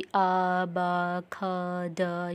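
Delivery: "आ, बा, ख, द" as separate letter sounds; phonetic sounds. Shown as a demonstration. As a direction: sound out "आ, बा, ख, द" one by one, pausing between. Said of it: "सब phonetics हैं". ("आ, बा, ख, द" अलग-अलग ध्वनियों में)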